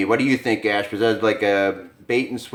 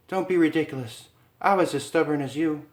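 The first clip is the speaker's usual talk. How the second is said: higher, fond protesting wife; Lisa; 8 semitones higher than usual. Acted. Wife to husband, higher fond protest. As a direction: brush off his guilt. Lisa's voice.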